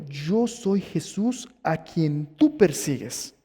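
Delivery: slow, weighty self-revelation; Jesús. Acted. Jesus answers Saulo's question who are you, slow solemn declaration. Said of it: "Le dijo".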